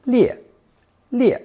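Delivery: 列 said in Mandarin fourth tone, falling sharply from high. Shown as a demonstration. Teacher shows learners how 列 is pronounced, fourth tone. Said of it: "It's also pronounced fourth tone".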